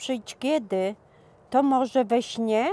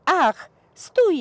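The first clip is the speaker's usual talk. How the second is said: a sudden higher, louder cry of Ach, stój; desperate girl begging her vanishing lover to stop, crying out; anguished cry; 8 semitones higher, 5 dB louder than usual. Acted. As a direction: call after the vanishing lover in despair.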